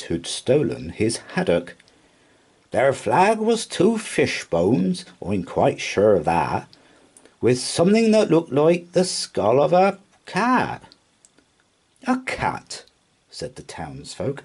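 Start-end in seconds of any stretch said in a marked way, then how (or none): 2.7-10.8 high, earnest sailor telling his tale; skipper's voice. A narrator's reading. 12.1-12.8 very high-pitched, disbelieving question; incredulous. A narrator's reading. Townsfolk scoff in disbelief.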